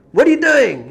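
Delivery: high, loud indignant demand; retold anger. Villager confronting the rebbe about his house, angry. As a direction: burst out in acted outrage.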